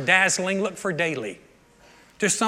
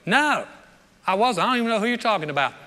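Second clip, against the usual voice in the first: Peter denying; 4 semitones higher than usual. Retold or imitated. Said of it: "And Peter goes".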